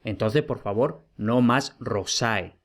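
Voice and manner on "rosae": "rosae" slowly, accent pushed to the end, ro-SAE; wrong final-syllable stress. Shown as a demonstration. Teacher begging students to drop this form, wrongly late-stressed rosae. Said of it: "En latín no existen palabras agudas".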